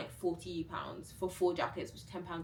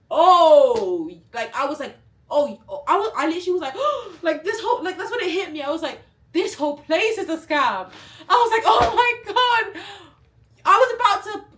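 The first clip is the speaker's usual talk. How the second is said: high, loud, laughing disbelief; loud high laughing rant; shocked laughing; 11 semitones higher, 16 dB louder than usual. Real, not acted. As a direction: squeal through the shock.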